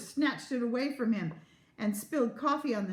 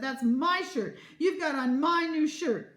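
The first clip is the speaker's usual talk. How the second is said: loud, raised-pitch accusation; indignant claim; 6 semitones higher, 5 dB louder, slower than usual. A narrator's reading. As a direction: protest it possessively, hitting the words hard. Parents quarrelling over shirt.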